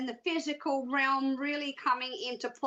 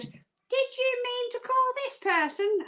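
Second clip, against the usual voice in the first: high-pitched virtual assistant question; Siri; 11 semitones higher than usual. Retold or imitated. Assistant checking a misheard command, mock high voice. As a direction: imitate a phone assistant querying a command. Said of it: "And she goes".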